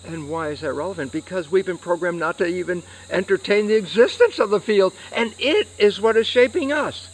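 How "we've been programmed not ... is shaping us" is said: raised, high-pitched, forceful lecturing; emphatic insistence. Real, not acted. Lecturer telling listeners what shapes us, emphatic and insistent. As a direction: drive the point home, loud and high. Lecturer drives home key point.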